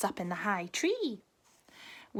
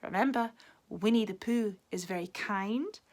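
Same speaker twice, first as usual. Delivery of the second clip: ordinary delivery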